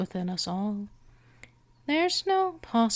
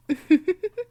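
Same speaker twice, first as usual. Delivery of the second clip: high, louder teasing giggle; playful vampire giggle; teasing laugh; 9 semitones higher, 6 dB louder than usual. Acted. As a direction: laugh knowingly at the listener's eagerness. Teasing the eager listener.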